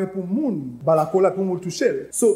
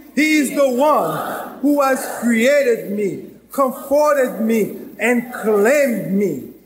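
slow, drawn-out proclamation; measured preacher's creed; solemn declaration; 3 semitones higher, 4 dB louder, much slower than usual. Acted. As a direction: lay each phrase down slowly and heavily.

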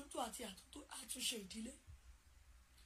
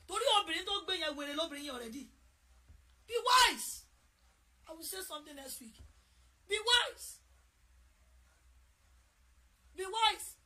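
urgent preaching; 3 semitones higher, 8 dB louder than usual. Real, not acted. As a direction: drive the warning home. Preaching climax to mothers.

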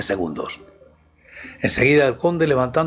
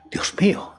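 hushed, higher gasp of awe; frightened awe; 6 semitones higher than usual. A narrator's reading. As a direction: breathe it out, a little frightened. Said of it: "me asustáis". (susurro asustado)

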